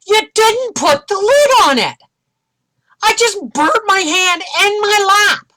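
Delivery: high, loud scalded complaint; outraged yelling. Retold or imitated. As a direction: yell it at the server in pain. Re-enacts outburst after being scalded.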